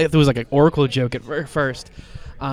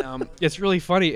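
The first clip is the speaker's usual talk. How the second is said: ordinary talk, nothing marked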